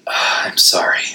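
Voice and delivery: whispered, sighing sorry; sighed apology. A narrator's reading. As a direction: breathe out the apology. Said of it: "I sighed".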